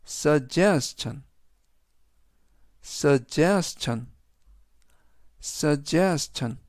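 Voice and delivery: suggestion, drawn out very slowly as sug-GES-tion; slow teacher modelling suggestion; slow model pronunciation. Shown as a demonstration. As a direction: stretch suggestion out for learners. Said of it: "이라고 발음하시면 되겠습니다".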